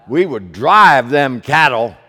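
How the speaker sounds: loud, punchy cowboy call; mock cattle drive. Pastor recalling Westerns to congregation, loud cowboy call. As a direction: belt it like driving a herd.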